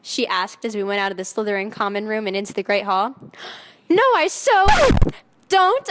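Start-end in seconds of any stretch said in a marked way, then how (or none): 3.9-5.9 much higher, louder denial; shouting. A narrator's reading. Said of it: "I shouted".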